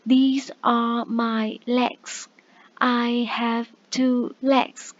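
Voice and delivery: put-on very slow, each word spaced out for children; slow, clear teacher demo; slow model sentence. Shown as a demonstration. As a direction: model it slowly for young learners. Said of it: "mình sẽ nói là".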